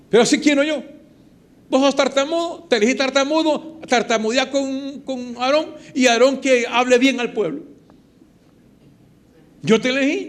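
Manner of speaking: God telling Moses He chose him; God's voice. Retold or imitated. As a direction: speak as God settling the matter. Preacher voicing God to Moses.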